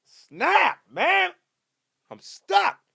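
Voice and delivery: ordinary talk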